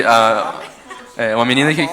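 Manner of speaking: rindo. Real, not acted. Rindo ao contar.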